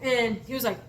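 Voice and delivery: normal talking voice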